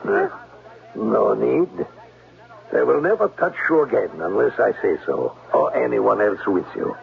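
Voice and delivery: put-on menacing German accent. German-accented Klemper hints at what he wants once they're alone. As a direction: let the threat creep into the German accent.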